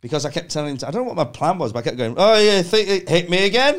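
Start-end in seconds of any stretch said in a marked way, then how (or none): 2.2-3.7 louder, higher goading dare; mock taunt. Egging on the man who keeps hitting him, goading. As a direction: goad him to hit you again.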